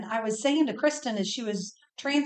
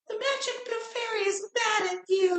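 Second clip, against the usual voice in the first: very high-pitched squeaky fairy voice; 11 semitones higher than usual. Acted. Imitating little sister refusing chores, high squeaky fairy. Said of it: "the high squeaky voice would say".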